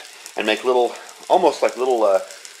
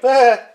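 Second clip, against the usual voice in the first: mock yelp; 12 semitones higher, 9 dB louder than usual. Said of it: "and I'm just like".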